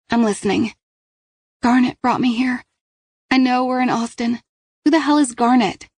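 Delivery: put-on high, tense questioning girl; teen girl voice. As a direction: voice the scared teen. Kat asks Paige.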